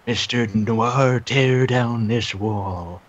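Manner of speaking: slow, grand speech parody. Pretend. Reagan quote parody.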